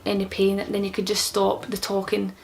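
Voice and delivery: ordinary talk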